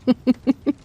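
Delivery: short bright burst of laughter; a quick, playful chuckle; amused laugh. Acted. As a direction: laugh at his eagerness. Teasing laugh at eager answer.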